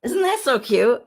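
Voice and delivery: ordinary talk, nothing marked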